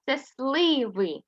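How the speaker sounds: сливи drawn out slowly; teacher naming the picture slowly; slow modelling. Shown as a demonstration. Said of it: "Є у нас такий малюночок".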